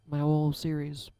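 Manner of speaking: ordinary delivery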